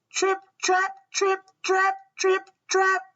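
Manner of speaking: high, light chanted trip-trap; tiny hooves tapping out an even beat; little hoofsteps. A narrator's reading. Smallest goat's hooves on bridge.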